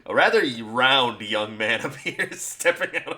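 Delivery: laughing narration. A narrator's reading.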